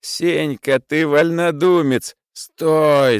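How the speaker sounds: high, loud, slow outcry; shouting. A narrator's reading. Prokharchin shouting to stop the rising clamour, loud. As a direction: shout him down, dragging each word. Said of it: "закричал господин Прохарчин".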